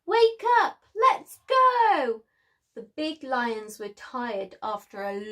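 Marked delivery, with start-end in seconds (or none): 0.0-2.2 very high, loud wake-up call; high, loud cub call; excited call. Acted. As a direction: rouse the sleepy pride. Lion wakes pride, story voice.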